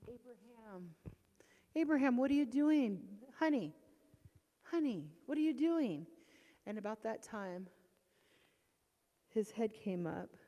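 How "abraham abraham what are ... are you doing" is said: high, begging question; pleading call. Retold or imitated. Mother pleading with her son, high and urgent. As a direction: coax him, pitched up and urgent. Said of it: "And I said".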